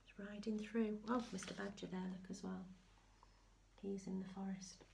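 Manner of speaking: plain reading voice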